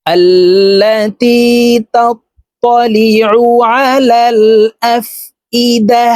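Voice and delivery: slow, high, melodic tilawat; Quran recitation. Shown as a demonstration. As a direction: chant it slowly with tajweed. (ধীর সুরেলা কুরআন তিলাওয়াত)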